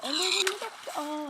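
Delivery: high, loud alarmed cry; startled. Real, not acted. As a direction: jump at another monster. Startled by monster.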